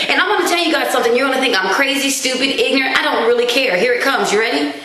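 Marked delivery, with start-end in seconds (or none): none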